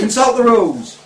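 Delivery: rallying cry to check the rules; triumphant proclamation. Acted. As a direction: announce it like a grand decree. Proclaiming the rule book.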